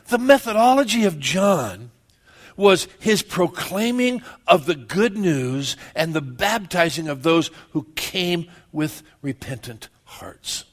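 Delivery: ordinary talk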